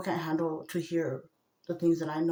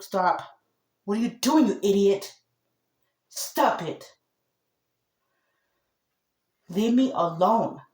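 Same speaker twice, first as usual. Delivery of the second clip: rebuking shout; 3 semitones higher, 9 dB louder than usual. Real, not acted. Woman rebuking the spirit tormenting her, shouting angrily. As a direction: drive it away with a furious yell. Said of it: "as in rebuke".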